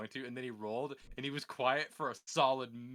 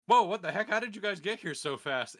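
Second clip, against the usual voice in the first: startled summoner; 7 semitones higher, 8 dB louder than usual. Retold or imitated.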